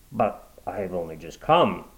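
puzzled Watson. Acted. Watson asking the woman why, bewildered.

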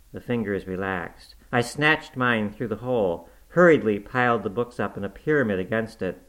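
plain reading voice

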